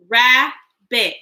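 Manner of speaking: "Rabbit" broken into two beats with a clear gap; split into syllables. Shown as a demonstration. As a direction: say "Rabbit" one syllable at a time. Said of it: "Break words into parts with me".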